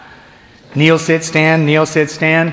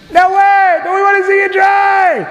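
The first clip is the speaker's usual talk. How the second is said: twisted heckler; 10 semitones higher than usual. Retold or imitated. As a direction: yell it like the sicko in the crowd who wants a show. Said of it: "twisted individual in the back".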